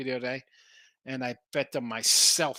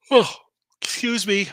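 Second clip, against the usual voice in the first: yawning; 6 semitones higher, 8 dB louder than usual. Real, not acted. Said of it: "My little yawn there".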